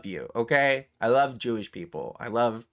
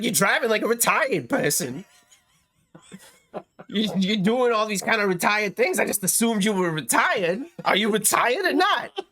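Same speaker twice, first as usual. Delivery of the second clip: higher, laughing taunt, mock innocent; teasing driver; 8 semitones higher, 3 dB louder than usual. Retelling the TikTok guy taunting the old man, laughing. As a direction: needle him with a laughing lilt on retired. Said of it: "that inflection".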